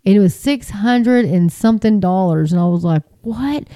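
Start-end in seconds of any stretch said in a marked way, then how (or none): 3.2-3.7 high, soft rising "what?"; retold disbelief. Telling of a huge electricity bill, incredulous. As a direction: quietly squeak out mock disbelief.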